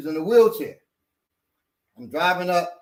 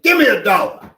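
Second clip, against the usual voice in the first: loud, raised-pitch barked demand; aggressive demand; 5 semitones higher, 11 dB louder than usual. Retold or imitated. Apple in a wheelchair demanding money from a driver, aggressive. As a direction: imitate him demanding it forcefully. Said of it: "He was getting aggressive like that".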